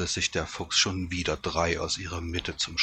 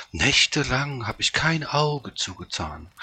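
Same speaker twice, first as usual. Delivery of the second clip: self-pitying flattery; 7 semitones higher, 4 dB louder than usual. A narrator's reading. Fox plays up its sacrifice.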